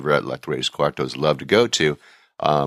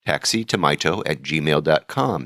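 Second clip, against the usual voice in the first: ordinary delivery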